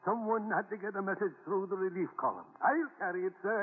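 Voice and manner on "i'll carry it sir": usual speaking voice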